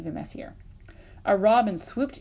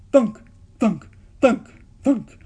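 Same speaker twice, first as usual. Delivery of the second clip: loud sound effect thunks; 4 semitones higher, 11 dB louder, slower than usual. A narrator's reading. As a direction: bounce each thunk out. Pine cones lobbed at bears.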